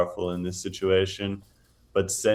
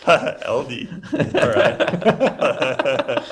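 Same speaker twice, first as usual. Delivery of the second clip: real laughter breaking through the words, higher and louder; laughing; 6 semitones higher, 4 dB louder, much slower than usual. Real, not acted. Commentator tickled by a co-host's joke, laughing. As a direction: laugh while repeating the joke.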